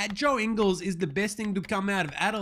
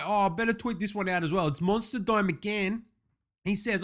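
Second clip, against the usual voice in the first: ordinary talk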